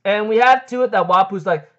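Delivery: ordinary talk, nothing marked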